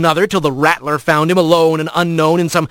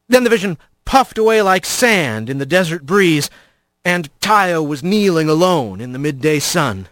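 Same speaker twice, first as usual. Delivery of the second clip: ordinary delivery, nothing marked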